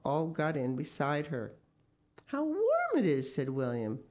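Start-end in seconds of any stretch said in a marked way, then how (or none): 2.2-3.3 high-pitched cosy pleasure; pleased little boy; delighted child. Acted. Son delights in the nest.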